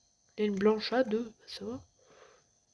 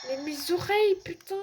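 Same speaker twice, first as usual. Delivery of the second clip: pained exclamation; 7 semitones higher, 6 dB louder than usual. Real, not acted. Loud jumpscare hurts ears.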